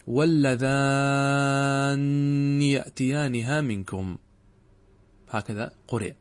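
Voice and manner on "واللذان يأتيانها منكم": slow, drawn-out tajwid reading; Quranic recitation. Shown as a demonstration. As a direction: recite it as Quran with a long doubled nun. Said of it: "وقد قرئ". (تلاوة مجوّدة بطيئة بتشديد النون)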